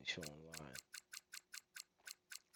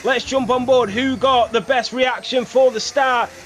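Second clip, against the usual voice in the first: louder, excited play-by-play; race commentary; 21 dB louder than usual. Acted.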